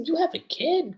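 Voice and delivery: high-pitched incredulous question; mock shock. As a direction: imitate a stunned friend, voice shooting up. Retold shock at Lucy having kid.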